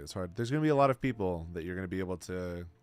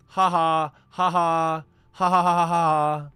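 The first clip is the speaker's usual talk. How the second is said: high, loud taunting "ha ha ha"; mocking fake laugh; 7 semitones higher, 11 dB louder, slower than usual. Real, not acted. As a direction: cackle at them for falling for it.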